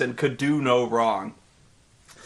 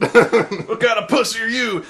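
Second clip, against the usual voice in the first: macho sneer; 5 semitones higher, 3 dB louder than usual. Retold or imitated. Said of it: "He's like".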